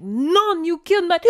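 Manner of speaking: high, loud accusing shriek cut by a gasp; hammed-up outraged wail. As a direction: shriek in mock grief, then gasp. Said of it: "the woman is like".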